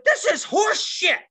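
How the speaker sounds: high, slow, loud mock outrage; irate woman. Angry woman at the other table, screeched complaint. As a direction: screech it like a furious customer.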